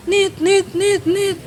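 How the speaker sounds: high, far beeping tone; imitated dial tone. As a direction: mimic the phone cutting off. Phone hung up, beeping. (bunyi telepon terputus)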